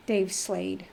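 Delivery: usual speaking voice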